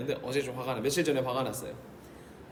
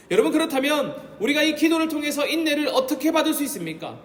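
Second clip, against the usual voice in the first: higher, louder sermon climax; full-force preaching; 7 semitones higher, 6 dB louder than usual. Acted. Pastor pressing the congregation, higher and louder. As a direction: drive the point home from the pulpit.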